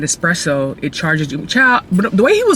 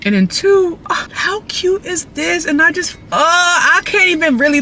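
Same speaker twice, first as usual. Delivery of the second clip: gushing; 10 semitones higher than usual. Real, not acted. Gushing over cup.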